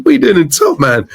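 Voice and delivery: excited repeat. Retold or imitated. To [name] in the shop, excited disbelief. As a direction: ask it again, giddy and disbelieving.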